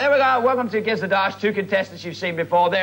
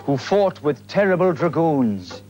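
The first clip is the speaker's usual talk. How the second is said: slow, low storyteller voice drawing out the eerie words; spooky tale narration; 7 semitones lower than usual. A narrator's reading. Host telling viewers an eerie Highland tale, mock-spooky narration. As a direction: spin the yarn like a fireside legend.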